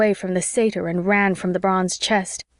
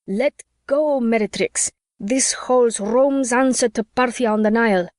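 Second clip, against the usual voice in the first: raised Roman commander voice; yelling; 3 semitones higher than usual. A narrator's reading. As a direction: shout it out like a Roman. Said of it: "Mark yelling".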